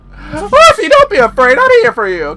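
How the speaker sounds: put-on high, loud coaxing baby voice; mock sweet talk. Player calling after a runaway horse, high mock coaxing. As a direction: coo at the horse in a squeaky call.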